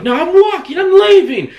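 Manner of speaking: high, loud stubborn insistence; angry insistence. Retold or imitated. Patient refusing the wheelchair, angry shout. She was pissed.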